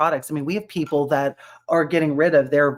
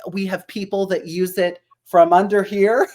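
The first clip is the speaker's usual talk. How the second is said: usual speaking voice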